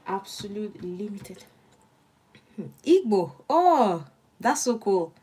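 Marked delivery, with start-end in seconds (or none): none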